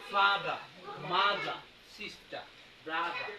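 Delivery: British accent, final r dropped. Shown as a demonstration. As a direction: end each word on an open British vowel. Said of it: "কিন্তু ওরা বলে".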